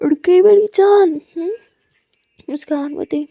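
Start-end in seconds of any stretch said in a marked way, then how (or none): 0.1-1.5 affectionate wake-up. Acted. Aadiya waking her love on call.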